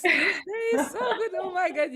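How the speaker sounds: loud, high, laughing delight; laughing with joy. Real, not acted. Host moved by her guest, laughing loudly with joy. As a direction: burst out laughing, overjoyed and a little teary.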